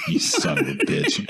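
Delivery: laughing. Real, not acted. Laughing at the insult joke.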